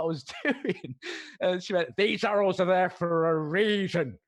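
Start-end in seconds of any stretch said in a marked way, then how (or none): 2.0-4.2 slow, louder scolding stranger; stern lady. Retold or imitated. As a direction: lecture like a prim shopper. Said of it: "like that".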